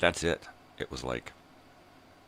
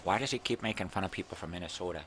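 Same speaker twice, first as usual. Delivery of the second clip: imagined critic; 4 semitones higher, faster than usual. Acted. Voices imagined critic's question.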